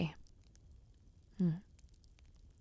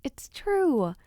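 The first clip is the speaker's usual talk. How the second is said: ordinary talk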